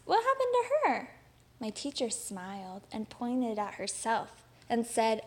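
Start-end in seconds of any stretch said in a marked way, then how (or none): 0.1-1.1 put-on a high, eager child's question; curious question. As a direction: ask it high and wide-eyed. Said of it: "I raised my hand and asked".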